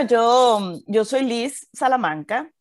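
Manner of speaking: normal talking voice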